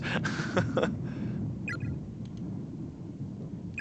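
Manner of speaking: high breathless laugh; real laugh. Real, not acted. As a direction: burst out laughing at the line. Reader laughs at text.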